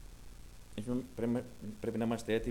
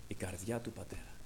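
soft, breathy whisper, much lower; 8 semitones lower, 12 dB quieter than usual. Real, not acted. Preacher whispers to the congregation about the father's heart. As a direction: let it hang as a hushed secret.

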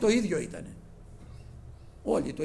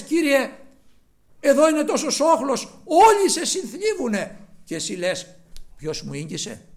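high, loud protest; astonished disciples; incredulous disciples; 9 semitones higher, 9 dB louder than usual. Retold or imitated. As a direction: exclaim in disbelief at the crowd. Disciples protesting about the crowd.

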